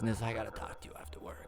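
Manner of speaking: fast, soft conspiratorial whisper. Retold or imitated. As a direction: whisper it like a secret in the break room. George secretly asks to talk.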